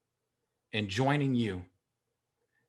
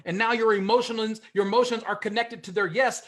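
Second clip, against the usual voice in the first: usual speaking voice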